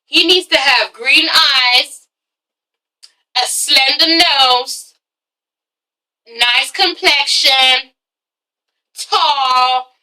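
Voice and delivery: slow, drawn-out listing with long pauses; mock-picky, unhurried recital; picky wish list. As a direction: savour each item slowly, imitating a choosy shopper. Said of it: "she's like".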